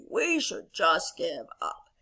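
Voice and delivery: low, slow, defeated toad; Toad character voice. Acted. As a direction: give up glumly, dragging it out. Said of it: "Toad returns back to Frog and he says".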